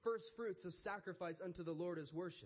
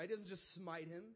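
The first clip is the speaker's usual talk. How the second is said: usual speaking voice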